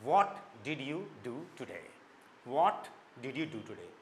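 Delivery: "what" with lips rounded, whole line very slow. Shown as a demonstration. Teacher repeats the question slowly with rounded lips. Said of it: "गोल मुंह करके बोल रहा हूँ".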